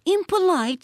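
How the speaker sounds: high indignant echo. A narrator's reading. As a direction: throw the word back, offended. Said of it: "said William with some indignation".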